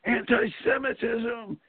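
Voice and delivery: high, drawn-out mock cry; imitated yell. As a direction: imitate a speaker crying out to a crowd, stretching it. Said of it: "top of his voice, he yells out".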